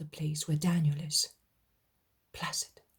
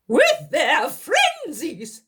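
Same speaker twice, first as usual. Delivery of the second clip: mocking contempt; 11 semitones higher, 9 dB louder, much slower than usual. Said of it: "he says".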